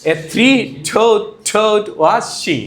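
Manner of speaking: slow, deliberate tongue twister; slow demo read; tongue twister drill. Shown as a demonstration. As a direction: stretch it out word by word. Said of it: "কি হচ্ছে এটা".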